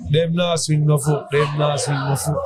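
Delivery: slow patois chant; Jamaican patois. Retold or imitated. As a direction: hum the hook in patois. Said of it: "it just came to me".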